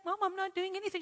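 high, soft imitation of a girl; protesting daughter. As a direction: protest innocently to mum, high and quiet. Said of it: "And she's like".

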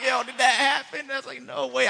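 high, loud re-enacted astonishment; amazed disbelief. Asking his friend about the breakthrough, amazed disbelief. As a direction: blurt it out, giddy and high-pitched.

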